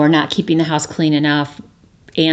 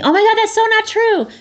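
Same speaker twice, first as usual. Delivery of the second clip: much higher, quick mock protest; gushing denial; faster than usual. Mocking a friend jumping on someone's confession, high and quick. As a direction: jump in and deny it. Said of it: "jumping on them and saying".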